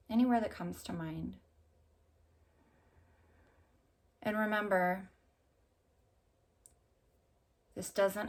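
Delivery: unhurried meditation voice with long pauses; calm, spacious guided-visualization delivery. Acted. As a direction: guide them gently through an imagined walk, leaving space between phrases. Leads a walking meditation.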